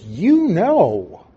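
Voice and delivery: "You" drawn high and punched in "You know"; stress on You. Shown as a demonstration. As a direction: lean hard on the word "You". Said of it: "the word "you" is emphatic".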